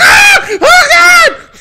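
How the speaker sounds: full-blast, very high scream; little-girl shriek. Retold or imitated. Storyteller reliving the jump scare, shrieking like a little girl. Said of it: "I shriek like a little girl".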